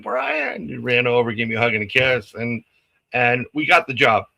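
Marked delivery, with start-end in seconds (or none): 0.0-0.7 high, excited call across the room; a kid spotting his old friend and shouting his name; child calling out a name. Retold or imitated. Boy runs to Brian calling his name joyfully. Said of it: "he was like".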